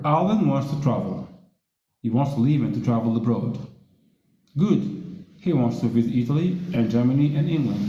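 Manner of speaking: teacher reading a dialogue. Shown as a demonstration. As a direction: clear read-aloud dialogue for learners. Teacher reads an English dialogue aloud.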